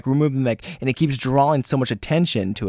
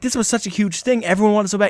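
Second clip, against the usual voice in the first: excited; 8 semitones higher, faster than usual. Retold or imitated. Quoting players' hype.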